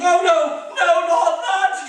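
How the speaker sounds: high, loud and slow, begging in panic; panicked plea. Acted. Dragon begging the children not to yell boo, terrified. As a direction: squeal it out in terror.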